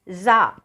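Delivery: "जा" pronounced za, pitched low; buzzed z sound. Shown as a demonstration. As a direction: buzz the z in za for "जा". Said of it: "इसको जा नहीं बोलेंगे". ("जा" को ज़ा बोलें)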